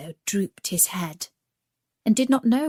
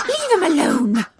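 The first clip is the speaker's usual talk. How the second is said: sharp, raised fierce mother's command; 8 semitones higher, 6 dB louder than usual. Acted. Mother duck fiercely defends her duckling from a stranger, fierce and raised. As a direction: snap it out to protect her child. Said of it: "she said fiercely".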